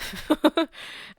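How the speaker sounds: short, high, loud laugh; amused laugh. Real, not acted. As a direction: let out a quick high laugh. Laughs after aside about astrology.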